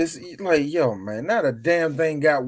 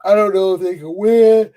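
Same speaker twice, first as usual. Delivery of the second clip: lightly voiced doubtful friend, louder at the end; a hint of the friend's unsure voice; imitating friend; 3 semitones higher, 8 dB louder than usual. Mocking his friend Chris's old prediction, light doubtful imitation. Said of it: "Remember when you told me".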